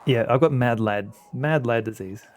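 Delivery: ordinary talk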